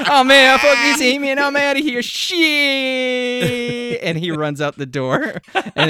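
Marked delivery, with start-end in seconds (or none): 0.1-4.0 panicked. Acted. Frank panics at the bear.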